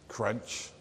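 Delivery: quiet imitated bite; a soft crunch between jabs; apple crunch. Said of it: "took crunch out of his apple".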